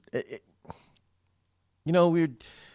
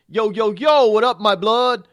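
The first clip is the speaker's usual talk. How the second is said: much higher and louder hammed-up street greeting; mock slang greeting; 10 semitones higher, 10 dB louder than usual.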